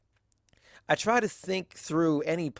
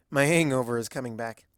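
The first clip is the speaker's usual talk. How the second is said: usual speaking voice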